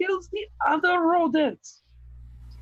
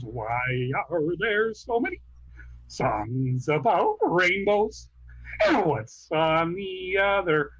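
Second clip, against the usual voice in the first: lower, slow wistful frog voice; Kermit impression; 9 semitones lower, slower than usual. Kermit wondering aloud about rainbow songs, slow. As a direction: muse slowly like a lightly voiced Kermit. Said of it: "give me characters from The Muppet Show".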